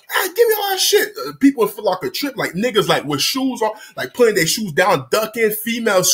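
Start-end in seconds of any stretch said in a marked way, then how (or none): 0.1-1.1 high-pitched mock holdup shout; robber's demand. Robbers to store staff, high-pitched demanding shout. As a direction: order it in an imitated high yell.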